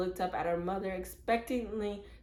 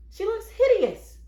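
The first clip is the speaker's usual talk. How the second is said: high, loud cry of dismay; distressed outburst; 14 semitones higher, 10 dB louder than usual. Acted. Mother tells her friend about Mary, a loud high cry. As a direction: burst out in horror.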